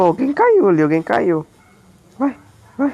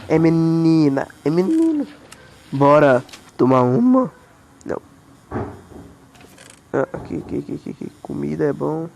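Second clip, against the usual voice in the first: low goofy character voice; silly low voice; 12 semitones lower than usual. Pretend. Gamer clowning for his friends in a low goofy voice. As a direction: play a dopey brute.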